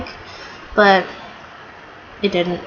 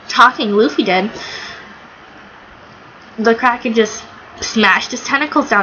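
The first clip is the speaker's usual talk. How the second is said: ordinary talk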